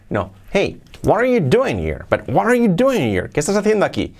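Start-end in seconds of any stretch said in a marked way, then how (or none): 0.5-3.3 "what are you doing here" swooping high, words linked; animated intonation. Shown as a demonstration. Teacher contrasting it with the monotone, high melodic question. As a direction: call out the question with big rises and falls. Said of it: "Con entonación".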